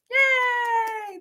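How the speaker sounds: high cheer for a winner; excited yay. Real, not acted. Host announcing a giveaway winner, excited cheer. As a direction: celebrate the win.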